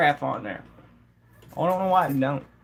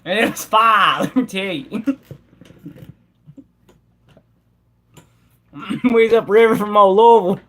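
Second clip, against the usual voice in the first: put-on country accent; 3 semitones higher than usual. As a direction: spin a moonshine yarn like an old Southern country boy. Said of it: "I'm gonna get you to talk country".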